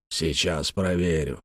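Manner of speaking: slow, low, soft sarcastic echo; quiet, biting mockery; caustic mimicry. A narrator's reading. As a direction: repeat it mockingly, drawn out and low. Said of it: "язвительно повторил он".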